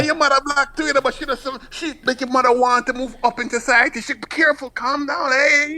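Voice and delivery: high, fussing mother's warning; high-pitched mock mother's caution; anxious mother. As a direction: warn him to calm down like a nervous mum. Mother warning her son.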